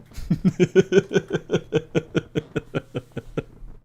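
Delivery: long wheezy staccato laugh; helpless laughter. Real, not acted. Streamer cracking up after threatening to ban chat, laughing. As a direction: crack up in a drawn-out cackle.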